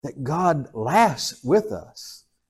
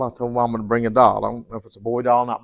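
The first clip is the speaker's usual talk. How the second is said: ordinary talk, nothing marked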